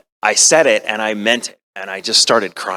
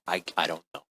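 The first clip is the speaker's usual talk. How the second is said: broken murmur; 5 semitones lower, 14 dB quieter, faster than usual. Retold or imitated. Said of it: "He's like".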